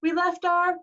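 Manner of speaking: high, loud dismay; Grover alarmed. A narrator's reading. Satyr realizing what was left on the bus, high alarm. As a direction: blurt it high, cut off unfinished.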